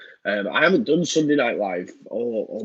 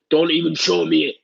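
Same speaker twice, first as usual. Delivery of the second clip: louder, higher mock-angry refusal; imitating fuming mate; 5 semitones higher, 4 dB louder than usual. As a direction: snap it as the fed-up mate, half in jest. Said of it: "'Cause he's fuming".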